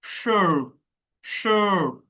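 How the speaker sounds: শার্প said slowly with a long vowel; drawn-out vowel. Shown as a demonstration. Teacher modelling the colon's long vowel, শার্প drawn out. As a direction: hold the vowel of শার্প before closing it. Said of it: "আটা টেনে বলতে হবে".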